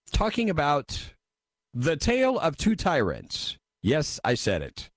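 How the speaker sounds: usual speaking voice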